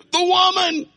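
very high, loud, whining blame; hammed-up Adam pointing at Eve in a high whine; whiny Adam. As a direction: squeal it out to shift the blame. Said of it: "And Adam said".

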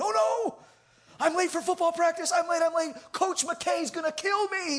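frantic, high-pitched wail; panicked kid. Retold or imitated. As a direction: cry out in alarm, imitating a panicking teen. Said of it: "almost lost his mind".

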